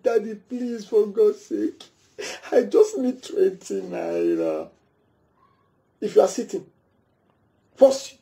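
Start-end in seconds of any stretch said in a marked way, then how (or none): 0.0-4.7 put-on begging child. Child begs father for money, slow and desperate.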